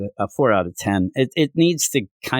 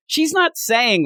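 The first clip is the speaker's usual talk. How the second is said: normal talking voice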